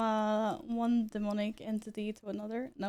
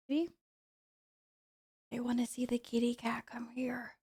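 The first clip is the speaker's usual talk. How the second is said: whispered coaxing. Real, not acted. Streamer calling her cat, whispering gently. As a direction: coax the kitty in a hushed whisper.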